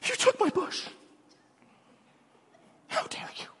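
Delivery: high whiny complaint, dropping to a hushed how dare you; mock sulking Jonah. Voicing Jonah complaining to God, sulky and whiny. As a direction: sulk like a spoiled prophet. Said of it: "in Jonah".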